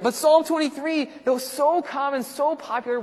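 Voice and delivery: high, loud, eager emphasis; real excitement. Real, not acted. Teacher telling the class how beloved the psalm is, excitedly. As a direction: marvel aloud, pitched high. Still amazed by the psalm link.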